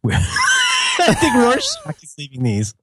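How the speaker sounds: laughing while talking; cracking up mid-sentence. Real, not acted.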